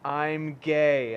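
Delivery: flat, loud declaration into the phone; loud and deadpan; blunt loud retort. Retold or imitated. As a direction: shut him down with two words. Retells blunt reply to caller.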